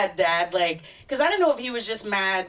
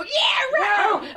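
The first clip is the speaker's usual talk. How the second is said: sarcastic yeah right; 10 semitones higher than usual. Retold or imitated. Comic mimicking Texans dismissing claims, sarcastic shout.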